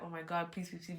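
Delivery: soft, hushed begging; desperate plea. Retold or imitated. Waiting on the friend's text, hushed pleading. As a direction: pray under your breath.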